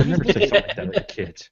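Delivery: quick laughing denial; laughing backpedal. Real, not acted. Host laughing as he insists he meant the friend. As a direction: rush it out through a laugh.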